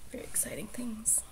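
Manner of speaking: whispered deadpan aside; wry whisper mocking her boring morning; whispered irony. Real, not acted. Vlogger joking to viewers about her boring morning in a whisper. Dry joke about waking up.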